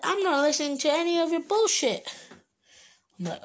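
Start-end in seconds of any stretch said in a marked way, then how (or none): 0.0-2.1 dismissive teacher. Retold or imitated. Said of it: "She was like".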